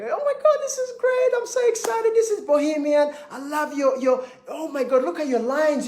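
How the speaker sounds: gushing guests. Retold or imitated. Guests gushing at shop opening.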